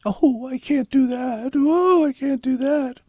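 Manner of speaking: high, slow squeaky fretful voice; fretful high-pitched refusal; mock attendant.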